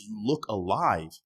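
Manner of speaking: ordinary delivery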